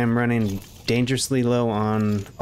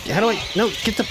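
frantic; 9 semitones higher, much faster than usual. Real, not acted. Fumbling controls under attack.

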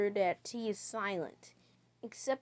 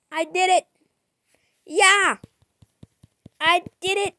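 loud, high victory cheer; excited cheer; 8 semitones higher, 11 dB louder than usual. Real, not acted. Player cheering at his own win, loud and high. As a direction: shout it out after winning.

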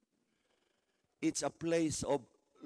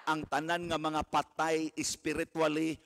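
usual speaking voice

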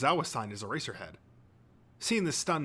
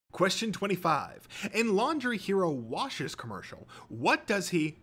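higher, brisk-spirited quiz host; quizmaster; 8 semitones higher than usual. A narrator's reading. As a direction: announce the question with flourish.

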